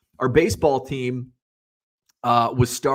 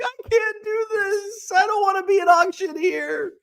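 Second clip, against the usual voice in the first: mock whining. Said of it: "I'm, like, crying on somebody's shoulder".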